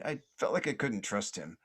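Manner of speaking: ordinary talk, nothing marked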